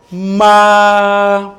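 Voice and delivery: "Ma" held long, high and level; first tone. Shown as a demonstration. Teacher has class repeat the first tone, high level "Ma". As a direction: sustain "Ma" loudly on one high pitch. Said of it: "le premier ton".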